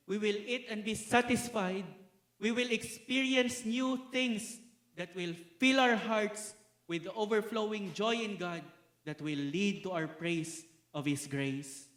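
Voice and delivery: exultant pulpit proclamation; joyful preaching. Acted. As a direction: lift the hall with the promise of heaven. Preaching at full force.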